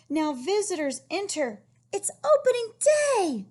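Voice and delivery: high, loud storybook announcement; excited read-aloud. Acted. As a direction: announce opening day with big excitement. Reading book climax.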